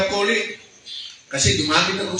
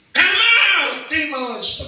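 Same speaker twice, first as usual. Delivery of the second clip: much higher, louder command; exorcism command; 10 semitones higher, 4 dB louder, much slower than usual. Shown as a demonstration. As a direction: bark it out at the demons. Commanding demons out.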